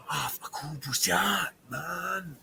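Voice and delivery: whispered confession; a whispered apology in broken Indonesian to the porter. Retold or imitated. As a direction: hush it out like a secret.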